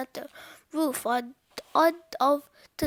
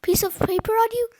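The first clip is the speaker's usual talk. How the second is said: higher, louder urgent question; urgent cry; 6 semitones higher, 7 dB louder than usual. A narrator's reading. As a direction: ask in a hurry. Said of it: "cried Fluffy".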